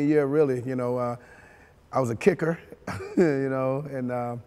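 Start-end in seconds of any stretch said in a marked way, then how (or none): 2.6-3.1 quick soft high chuckle; brief laugh at himself; tiny embarrassed chuckle; short laugh. Real, not acted. Laughs about being kicker.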